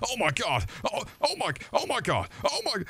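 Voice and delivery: high, squealed overreaction; hammed-up high falsetto gasping; mock game-ad guy. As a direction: squeal like an over-the-top ad actor. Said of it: "where a guy's going".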